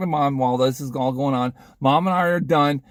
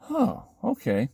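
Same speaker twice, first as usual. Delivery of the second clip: slow, drawn-out, higher reply; hesitant acceptance; 6 semitones higher, 4 dB quieter, slower than usual. Retold or imitated. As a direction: stretch it out, taking it in. Retold first reply at the car.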